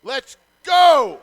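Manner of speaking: huge high shout. Real, not acted. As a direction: yell it at full power. Cheering a big play.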